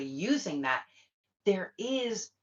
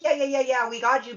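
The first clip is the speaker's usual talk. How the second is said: breezy, higher and louder hollow reassurance; mock fake support; 6 semitones higher, 7 dB louder than usual. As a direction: toss off empty promises like a fake friend. Mocking fake-supportive friends.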